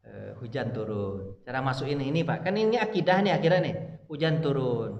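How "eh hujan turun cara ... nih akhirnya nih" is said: ordinary talk, nothing marked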